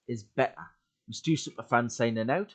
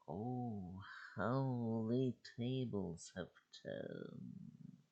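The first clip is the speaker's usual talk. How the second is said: very slow, soft, gloating sneer; sneering monster; 3 semitones lower, 16 dB quieter, much slower than usual. Acted. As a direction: savour the words like a creature that has won.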